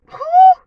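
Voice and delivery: sudden high, loud exhale; emotional gust of breath; loud whew. Real, not acted. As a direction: blow out a big emotional whew. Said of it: "emotional outburst".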